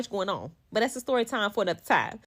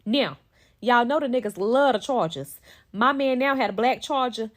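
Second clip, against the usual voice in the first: usual speaking voice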